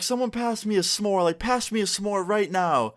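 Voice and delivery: put-on high, giddy drunk mom demanding a treat; tipsy mom.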